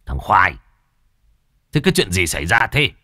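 mildly stern, puzzled shout; puzzled bark. A narrator's reading. Master questioning the half-dressed worker, sternly puzzled. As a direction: demand an explanation sharply. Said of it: "thì khó hiểu quát".

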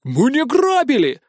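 high, slow outraged protest; indignant denial. A narrator's reading. Tuzik protests the parrot's slander, high indignant. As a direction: deny the slander hotly. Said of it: "возмутился наглой клевете Тузик".

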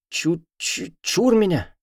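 high, stuttering shriek of fear; frightened stammer. A narrator's reading. Witch student stammers in fear at the heroine. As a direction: stammer it out in terror. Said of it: "начала заикаться та".

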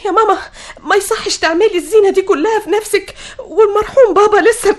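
high, upset pleading; distressed reproach. Acted. Daughter reproaching widowed mother for dressing up, distressed. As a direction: reproach her with rising distress.

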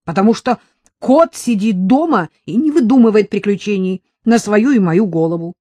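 a read-aloud husband's angry shout. A narrator's reading. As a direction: yell it at his wife in anger. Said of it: "закричал Сан Саныч".

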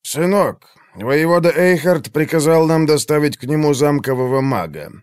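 put-on a stern old soldier, frowning; frowning old veteran. A narrator's reading. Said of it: "нахмурился Игнаций".

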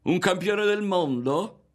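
high, drawn-out, rising question; loud, jeering disbelief; mocking. A narrator's reading. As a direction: taunt with exaggerated wonder. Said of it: "E rideva".

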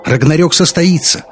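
loud, high impassioned declaration; calling out. A narrator's reading. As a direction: report fervently through a locked door. Pleading report through locked doors.

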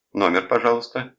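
telephone operator. A narrator's reading. Operator to a caller at the switchboard, high telephone-operator voice. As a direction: lift it into a brisk switchboard query. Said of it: "сказала телефонистка".

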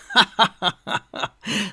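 high, loud laugh of despair; dejected laugh. A narrator's reading. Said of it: "Hablaty csüggetten felnevetett".

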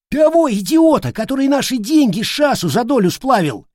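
high-pitched, heated accusation; indignant outburst. Acted. Soldier accuses leader of squandering money, indignant. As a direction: rant shrieking with indignation.

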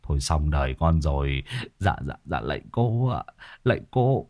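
low, soft stammering husband; cowed pleading. A narrator's reading. Husband who struck a medium, hushed dread. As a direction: drop pitch and volume, begging pardon.

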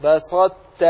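بسطت with heavy ط closure, released as ت; partial assimilation. Shown as a demonstration. Teacher isolating the word for students, بسطت with heavy ط merged into ت. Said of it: "أدغم الطاء في التاء مع إبقاء صفة الإطباق".

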